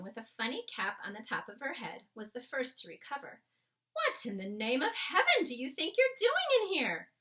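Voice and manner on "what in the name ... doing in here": high, loud angry maid; shouting at the intruder. Acted. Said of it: "she shouted angrily to Sophie".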